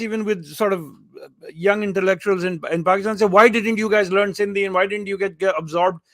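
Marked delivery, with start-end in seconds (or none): none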